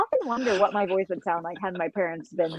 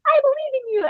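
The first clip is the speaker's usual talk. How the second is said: very high, sweet little cheerleading voice; cute high-pitched anime child, encouraging; 13 semitones higher than usual. Acted. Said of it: "sweet voices".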